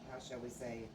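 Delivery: normal talking voice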